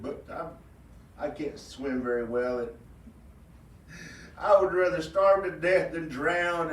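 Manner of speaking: mild pouting self-pity; self-pitying rabbit. Acted. Rabbit who cannot swim, mild self-pitying whine.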